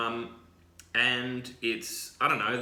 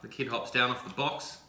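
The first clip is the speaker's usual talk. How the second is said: usual speaking voice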